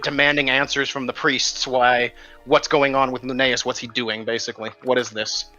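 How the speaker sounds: normal talking voice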